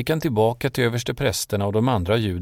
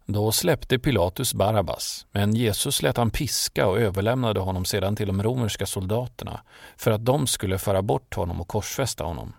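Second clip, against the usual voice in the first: plain reading voice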